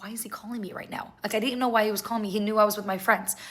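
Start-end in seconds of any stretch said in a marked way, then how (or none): 0.0-1.1 hushed, whispered aside to yourself; puzzled whisper. Retold or imitated. Looking at the phone as he calls, puzzled whisper. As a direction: whisper it, barely voiced, as a private question.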